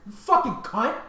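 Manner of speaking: mock insult. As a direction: spit out the retold slur lightly.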